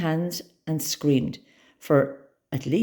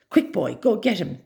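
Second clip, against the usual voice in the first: yelled; 7 semitones higher, 3 dB louder than usual. A narrator's reading. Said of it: "I yelled".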